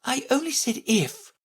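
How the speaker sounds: high, small plea from Alice; piteous pleading. A narrator's reading. Alice defending herself to the queens, piteous. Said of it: "pleaded in a piteous tone".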